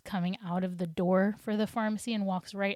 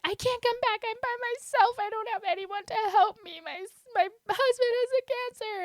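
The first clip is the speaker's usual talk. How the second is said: high, strained, tearful pleading voice, much higher; frantic crying plea; 14 semitones higher than usual. Retold or imitated. Mom at the pharmacy counter, tearfully pleading in a high, frantic voice. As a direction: retell the breakdown with a rising, tearful wail. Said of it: "that's when I freaked out".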